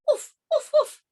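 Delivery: high little dog woofs; dog barking. Acted. Story's dog answers.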